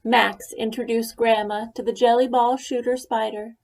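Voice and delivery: ordinary delivery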